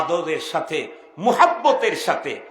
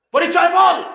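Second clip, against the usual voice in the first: high, loud official demand; sudden commanding shout; shouted interrogation; 12 semitones higher, 9 dB louder than usual. Acted. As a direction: fire off an order like a checkpoint officer. Acts checkpoint officer demanding identity.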